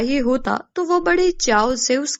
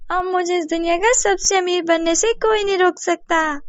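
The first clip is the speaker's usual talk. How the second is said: higher, triumphant schemer's voice; gloating neighbour; 7 semitones higher than usual. Acted. Babli boasts she'll be richest, gloating voice. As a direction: boast greedily of unstoppable riches.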